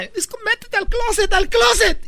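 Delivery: high, urgent falsetto woman; panicked woman. Acted. Woman hiding her lover from husband, frantic falsetto. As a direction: squeal the order in a panic.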